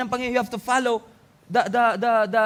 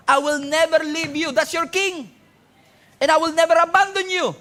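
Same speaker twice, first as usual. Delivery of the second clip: forceful preaching; 6 semitones higher, 4 dB louder than usual. Acted.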